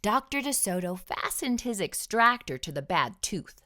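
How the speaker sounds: usual speaking voice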